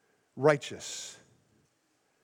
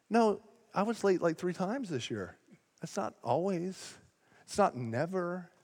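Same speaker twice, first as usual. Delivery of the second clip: soft, small-voiced mock rebuttal; understated wounded protest; meekly defensive; 7 dB quieter than usual. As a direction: correct the accuser quietly, under your voice. Acted defensive reply to the accusation.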